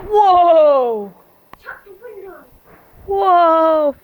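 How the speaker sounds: long, loud falling whoa; loud amazement. Real, not acted. Reacting to fireworks going off, loud amazement. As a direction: call out in amazement, drawing it out.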